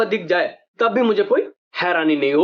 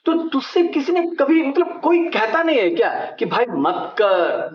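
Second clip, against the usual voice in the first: usual speaking voice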